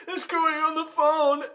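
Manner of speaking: very high, loud wail; mock hysterics. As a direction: squeal it in fake desperation. Acted arrested caller wails at police.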